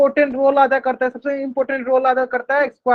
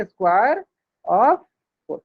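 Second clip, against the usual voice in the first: teacher repeating square of four slowly; slow demo reading; 3 semitones lower, much slower than usual. Shown as a demonstration. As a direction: square of four, with pauses between the words. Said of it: "ऐसे पढ़ेंगे".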